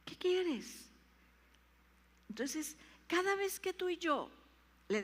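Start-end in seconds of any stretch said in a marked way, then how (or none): 0.0-0.9 higher, slow questioning plea; pleading. Acted. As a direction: ask it again and again. (súplica repetida, aguda)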